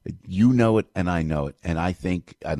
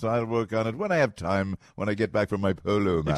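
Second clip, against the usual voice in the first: snooty waspy associate; 3 semitones higher than usual. Retold or imitated. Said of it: "sounds like a great waspy".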